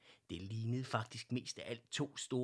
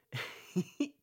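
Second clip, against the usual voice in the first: high, loud little laugh; bright giggle; 9 semitones higher, 4 dB louder than usual. Acted. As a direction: laugh out gleefully. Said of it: "Fru Lindberg grinede".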